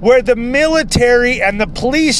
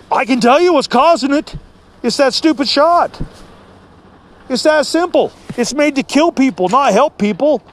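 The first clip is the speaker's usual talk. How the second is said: high-pitched, indignant comeback; ranting retort; 5 semitones higher than usual. Retold or imitated. Retelling a reply to the doctor about the shot, as a high-pitched rant. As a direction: fire back with the pitch jumped up. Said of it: "That's enough ranting and raving".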